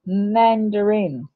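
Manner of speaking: mandarin said slowly, syllables drawn out; slow phonics demo of mandarin; stretched for listening. Shown as a demonstration. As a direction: draw out mandarin so the vowel can be heard. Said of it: "We're just listening for A".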